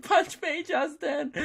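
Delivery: very high squealing mock fan; begging superfan. [name] fan begs him for a punch, mock squeal. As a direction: imitate a fan pleading in a high, laughing voice.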